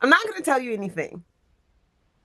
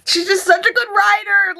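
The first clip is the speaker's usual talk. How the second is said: gushing praise; 11 semitones higher than usual. Real, not acted. Gushing about the author.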